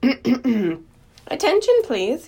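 drawn-out, high public announcement; stretched-out, formal call for attention; loud, firm voice. Acted. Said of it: "said in a loud, firm voice".

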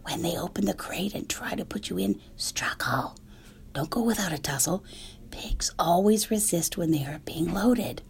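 urgent conspiratorial whisper; whispered advice. Acted. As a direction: goose whispering instructions to the pig. Goose advising Wilbur in whisper.

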